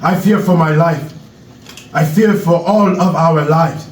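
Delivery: put-on measured speech in an African accent; Knowledge, African accent. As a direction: address the crowd gravely with an African accent. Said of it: "African accent".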